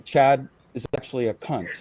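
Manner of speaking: low, understated imitation of a blunt verdict; lightly imitated low deadpan quote; Gonzo. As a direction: repeat the verdict low and unhurried. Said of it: "And then he's giving".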